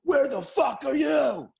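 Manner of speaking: put-on jealous boyfriend yelling at girlfriend down the phone; angry boyfriend. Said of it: "a man called and he says".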